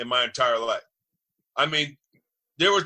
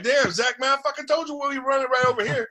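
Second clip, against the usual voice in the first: much higher, hammed-up complaint at Zach; mock angry complaint; 11 semitones higher than usual. Center griping at Zach about the run play, mock angry. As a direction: whine it up high like a fed-up teammate. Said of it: "I'd be like".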